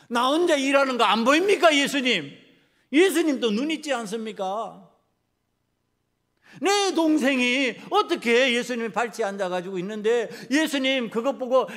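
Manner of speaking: loud, high-pitched indignant questioning; angry reproach. Acted. Martha asks Jesus whether he sees her working alone, indignant. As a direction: protest loudly as if badly wronged. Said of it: "그 화나는 것을".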